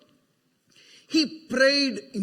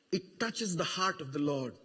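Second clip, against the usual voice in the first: hushed; 9 semitones lower, 12 dB quieter than usual. Acted. Repeated phrase, softer still.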